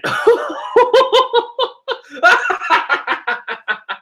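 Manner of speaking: real laughter bursting out high. Real, not acted. Fan reacting to Big Mom's mouth with a high laugh. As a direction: cackle at the panel.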